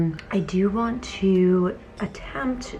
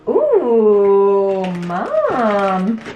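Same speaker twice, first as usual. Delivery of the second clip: loud, drawn-out touched coo; swooning thank-you to mom; touched delight; 7 dB louder than usual. Real, not acted. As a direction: gush over mom's surprise gift, stretching the words. Opens a surprise gift from mom.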